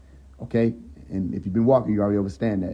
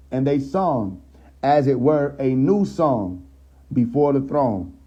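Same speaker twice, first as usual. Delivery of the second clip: rising sermon cadence; preaching scripture; 4 semitones higher, slower than usual. Acted. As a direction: proclaim it from the pulpit. Reads scripture aloud.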